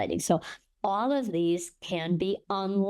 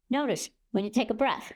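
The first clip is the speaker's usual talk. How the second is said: gasping; 4 semitones higher than usual. Shown as a demonstration. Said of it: "it's like gasping".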